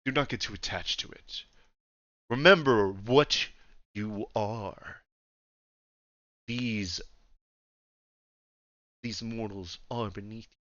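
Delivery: put-on soft, measured, dominant old ruler; old Emperor giving a grave reminder; refined old Emperor. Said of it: "very old, like, deep voice".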